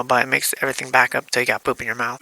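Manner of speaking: ordinary delivery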